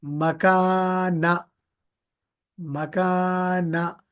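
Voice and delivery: मकाना with both long आ held out, start and end kept short; stretched long vowels. Shown as a demonstration. Teacher showing which letters to draw out. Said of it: "सिर्फ short vowel है उस पे".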